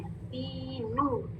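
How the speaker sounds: soft, slow दीनू on level pitch; rounded "noo". Shown as a demonstration. Teacher modelling for learners, soft rounded recitation. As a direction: stretch both long vowels of दीनू. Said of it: "rounding भी है और double ऊ sound".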